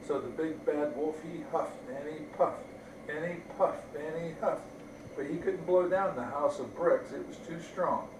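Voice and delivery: ordinary delivery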